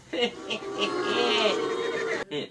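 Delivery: high evil cackle; villain laugh. Acted. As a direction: cackle after the threat. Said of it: "Graciana said".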